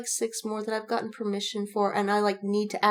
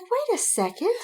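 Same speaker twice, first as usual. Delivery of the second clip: sudden realization; 14 semitones higher than usual. Retold or imitated. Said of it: "I'm like".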